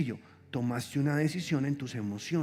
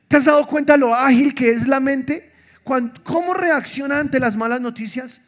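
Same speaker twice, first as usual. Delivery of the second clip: loud, high preaching climax; preaching at full force; 8 semitones higher, 10 dB louder than usual. Acted. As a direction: drive it home to the congregation. Preacher builds to a peak.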